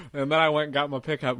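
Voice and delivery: ordinary talk, nothing marked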